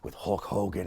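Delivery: low, soft mumbling impression; imitating a low-key host making excuses; subdued Piper. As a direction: play down an outburst in a quiet, halting murmur. Piper excusing the Sheik's rant.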